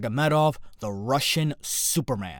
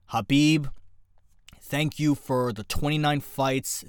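ordinary talk

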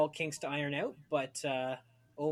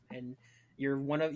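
normal talking voice

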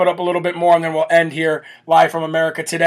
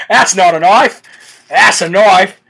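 slow, hammed-up Aussie movie-line impression; Australian accent; 4 dB louder, slower than usual. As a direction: draw it out in a broad mock Australian accent. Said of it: "imagine if I talked like that".

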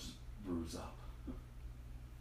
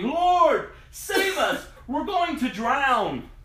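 high, loud cry for rescue, drawn out at the end; frightened disciples shouting to wake Jesus; panicked shout; 10 semitones higher, 5 dB louder, much slower than usual. Acted. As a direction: yell it like men sinking in a storm.

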